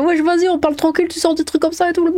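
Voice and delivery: high, fast mock voice of an annoyed guy; imitating the guy. Streamer mocks the guy getting worked up, high and rapid. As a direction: rattle it off fast and high, mocking him. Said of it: "Et il est là".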